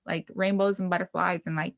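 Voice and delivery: ordinary talk, nothing marked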